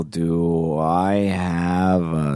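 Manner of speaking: extremely slow, dragged-out words; really slow. Shown as a demonstration. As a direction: stretch every syllable out. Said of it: "let's talk really slow".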